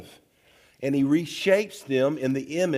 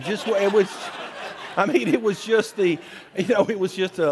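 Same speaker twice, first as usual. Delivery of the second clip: laughing; 8 semitones higher, 4 dB louder, faster than usual. Real, not acted. Laughs at his own confession.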